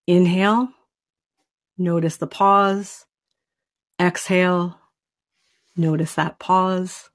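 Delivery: calm breathing meditation, unhurried; guided breathing. Acted. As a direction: lead them gently through each breath with long pauses. Leads a breathing exercise.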